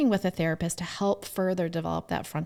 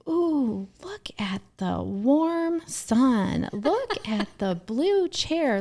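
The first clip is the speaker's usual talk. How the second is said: higher, drawn-out sing-song pointing things out; parent talking to little kids; 6 semitones higher, slower than usual. Retold or imitated. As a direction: imitate a mom narrating everything to a toddler. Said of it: "videos of me talking to my kids".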